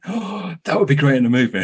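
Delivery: mock gasp of inspiration, pitched high; excited writer brain. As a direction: play your own greedy imagination lighting up. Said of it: "with writer brain going".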